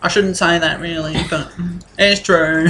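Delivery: a fussy clerk who finds everything filthy; lightly voiced old clerk.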